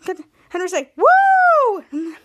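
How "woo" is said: high, loud whooping cheer; cheering woo. Retold or imitated. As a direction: whoop it out, pitched way up. Acts out Henry cheering.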